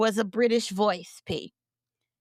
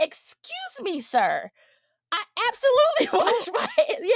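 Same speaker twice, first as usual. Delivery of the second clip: indignant retort; 10 semitones higher than usual. Retold or imitated. Correcting a stranger who says she didn't wash her hands, shrill with outrage. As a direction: play the insulted party, voice cracking up with laughing indignation.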